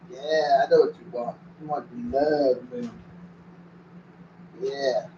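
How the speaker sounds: soft, slow cooing to the dog; hushed doggy talk, drawn out; tender pet talk. Real, not acted. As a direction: murmur lovingly to your dog.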